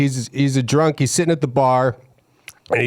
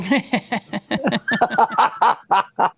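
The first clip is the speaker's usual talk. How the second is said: a long, high, delighted laugh at his own punchline; cracking up, can't hold it in; big laugh; 9 semitones higher than usual. Real, not acted. Comedian laughing hard at the punchline he just delivered.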